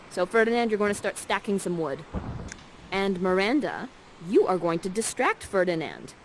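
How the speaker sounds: usual speaking voice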